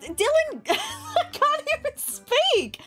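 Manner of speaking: high laughing, barely able to get words out; stunned laughter. Real, not acted. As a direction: laugh too shocked to speak. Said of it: "I'm so stunned that I can't even speak".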